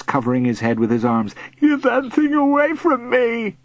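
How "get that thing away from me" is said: high, pleading whine; frightened whimper. A narrator's reading. Bully crouched in grass, whimpering. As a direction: whimper from under your arms. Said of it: "he whimpered".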